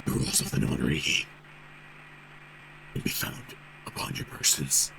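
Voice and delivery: low, half-whispered sinister voice; cold eerie voice. Acted. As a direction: speak low and cold, like a menacing captain. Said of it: "The voice continued, implaceable".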